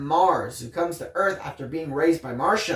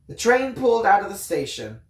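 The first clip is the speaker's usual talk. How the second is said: ordinary delivery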